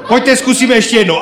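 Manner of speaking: ordinary talk, nothing marked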